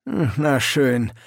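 slow, dry hm then a sigh; mildly unimpressed old sergeant, drawn out; weary sergeant. A narrator's reading. Sergeant sizing up the recruits, dry and weary.

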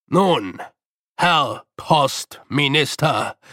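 the Master solemnly challenging Feucht with weighted pauses; dramatic pauses. A narrator's reading. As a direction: draw it out for effect like a ritual. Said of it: "Die Stimme zögerte ... zusätzliche Wirkung".

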